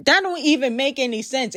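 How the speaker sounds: normal talking voice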